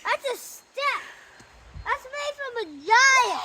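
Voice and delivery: loud call down from a tree; yelling across the distance. Real, not acted. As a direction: shout it so mom hears below. Child calling from up the tree.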